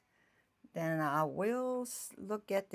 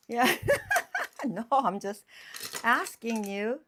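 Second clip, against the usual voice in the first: laughing; 5 semitones higher, 9 dB louder than usual. Real, not acted. Laughing after calling John.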